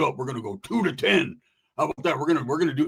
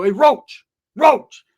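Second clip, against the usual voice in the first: loud, high call to a sleeper; high wake-up shout; 8 semitones higher, 10 dB louder, much slower than usual. Retold or imitated. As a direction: holler the name to rouse someone. Calling through window to wake Roach.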